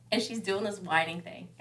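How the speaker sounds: usual speaking voice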